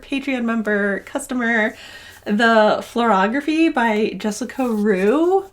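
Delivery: ordinary talk, nothing marked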